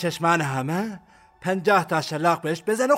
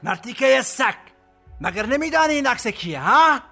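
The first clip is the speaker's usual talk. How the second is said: higher-pitched, furious interrogation; angry roar; 7 semitones higher than usual. Acted. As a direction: roar the insult, peak on the final question. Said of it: "عربده کشید". (عربدهٔ خشمگین)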